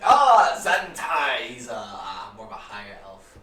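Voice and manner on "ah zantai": loud, high warm elf greeting. Acted. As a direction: hail an old friend grandly.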